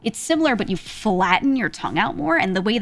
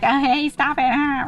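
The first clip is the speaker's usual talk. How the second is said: higher, laughing mock complaint to a friend; playful protest; 6 semitones higher, 3 dB louder than usual. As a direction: play-act being smacked, lightly. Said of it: "You could just say it like that".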